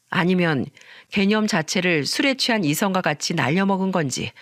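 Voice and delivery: ordinary delivery, nothing marked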